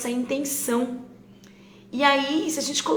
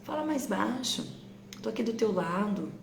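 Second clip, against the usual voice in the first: put-on softer, gentle words to the child; soft calm request; 7 dB quieter than usual. Shown as a demonstration. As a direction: say it quietly, close beside them. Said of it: "como eu tiro isso?".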